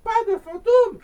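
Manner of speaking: surprised mother. Acted. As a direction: doubt it out loud as mum. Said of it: "redemanda sa maman".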